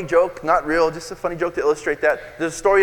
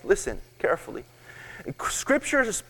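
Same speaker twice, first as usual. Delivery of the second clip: usual speaking voice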